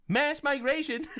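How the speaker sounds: high question breaking into laughter; amused mock disbelief. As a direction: feign shock while about to crack up.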